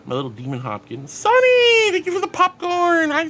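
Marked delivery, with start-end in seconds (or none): 1.1-3.3 high, loud squeal of delight; excited thanks. Real, not acted. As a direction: cry out the name, long and high, then gush thanks.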